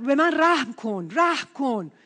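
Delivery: loud imitated cry; retold shout for mercy. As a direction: call out the beggar's plea. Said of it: "فریاد زد".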